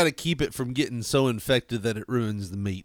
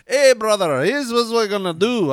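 higher-pitched, louder mock Scottish brogue; Scottish guy; 6 semitones higher, 3 dB louder than usual. Imitating a Scottish hoof trimmer his wife watches, mock Scottish accent. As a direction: hail a brother in a hammed-up Scottish accent. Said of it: "It's a Scottish guy, like".

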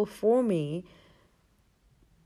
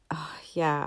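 whisper. Retold or imitated. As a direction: breathe it out as a secret. Whispered retold thought.

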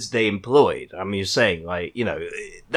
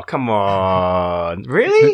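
exasperated, voice leaping up at the end; incredulous; 6 semitones lower, much slower than usual. Real, not acted. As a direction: protest in drawn-out disbelief. Disbelief at co-host's answer.

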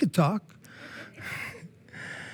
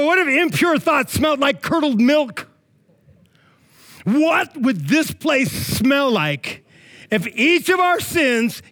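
high-pitched, insistent sermon riffing; animated preaching; 7 semitones higher than usual. Real, not acted. Preacher imagining each sin with its stink, animated preaching. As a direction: drive each question home to the crowd.

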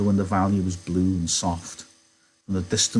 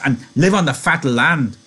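higher, louder, elated; giggly happiness; 7 semitones higher, 7 dB louder than usual. A narrator's reading. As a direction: exclaim it like a delighted child. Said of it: "Lenny giggled with happiness".

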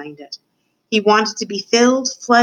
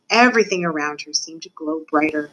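usual speaking voice

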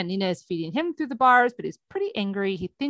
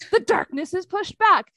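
ordinary talk, nothing marked

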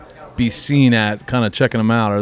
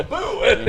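high mock-scary boo; playful boo; 11 semitones higher than usual. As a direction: jump out with a silly boo. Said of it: "I can go".

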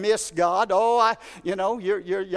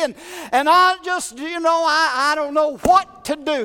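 higher, louder whiny complaint; complaining Elijah; 7 semitones higher, 5 dB louder than usual. Retold or imitated. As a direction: pour out the pity party. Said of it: "And he begins to complain".

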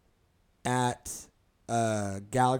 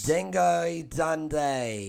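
put-on slow, drawn-out Australian accent, pitched up; 4 semitones higher, much slower than usual. Podcast host mocking Australians, slow hammed Australian accent. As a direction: stretch "Dingo Dundee" out like a broad Aussie. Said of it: "the best Australian accent I've done all day".

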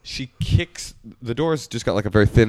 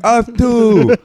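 high, loud laughing shout; crowd yells back; 13 semitones higher, 8 dB louder than usual. Retold or imitated. Deaf crowd shouting back at the MC, laughing. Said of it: "everybody like".

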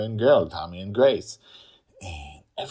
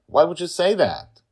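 loud, high shocked question; 12 semitones higher, 12 dB louder than usual. Acted. Sister shocked at brother's claim, loud. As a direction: ask it aghast.

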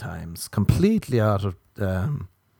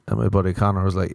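ordinary talk, nothing marked

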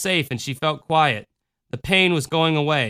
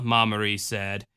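ordinary delivery, nothing marked

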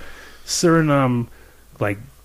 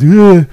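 loud groan; 7 semitones higher, 11 dB louder than usual. Retold or imitated. Tony's exaggerated groan.

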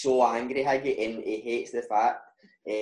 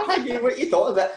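incredulous; 11 semitones higher, 4 dB louder, faster than usual. Retold or imitated. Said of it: "And I'm like".

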